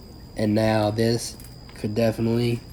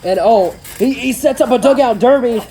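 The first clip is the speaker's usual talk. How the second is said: excited; 7 semitones higher, 4 dB louder than usual. Real, not acted. Big move in the match.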